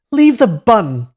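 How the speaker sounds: high, loud barked order; angry shout. Acted. Inn boy shouting at the beggar, angry order. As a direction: bark it at the thief.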